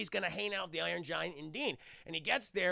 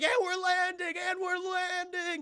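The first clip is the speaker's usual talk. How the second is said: put-on high, slow, woozy kid voice; spun-around kid announcing the landing, woozy; dazed kid; 12 semitones higher, 6 dB louder, slower than usual. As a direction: drone it out dizzy and concussed.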